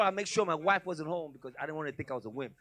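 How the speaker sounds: ordinary talk, nothing marked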